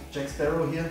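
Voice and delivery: normal talking voice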